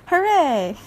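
high, celebratory cheer; delighted cheer. A narrator's reading. Iguana finally hears an offer of help, cheering. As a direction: cheer as if clapping along. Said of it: "Iguana clapped".

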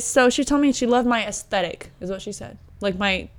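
usual speaking voice

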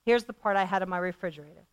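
ordinary talk, nothing marked

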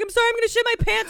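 high, rushed panicked apology; hammed-up frantic begging; mock desperate plea. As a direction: blurt it out in exaggerated urgency. Imagined desperate plea to a cop.

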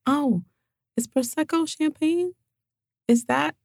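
puzzled, high voice; wondering aloud, baffled; confused. Retold or imitated. Puzzled guest at a toast.

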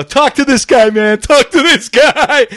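high, loud egging-on holler; mock rowdy high-pitched shouting; rowdy soldiers. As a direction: holler like young guys goading a buddy. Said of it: "And they're all laughing".

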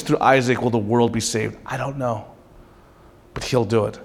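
soft, low comfort to a son; tender fatherly voice. Retold or imitated. Abraham softly reassuring his son on the mountain, tender. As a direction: reassure your son gently. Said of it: "Here's what Abraham is saying".

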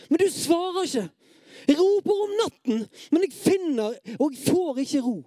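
high-pitched, desperate cry to God; impassioned plea from the depths; anguished psalm reading. Acted. Reads psalm as desperate outcry.

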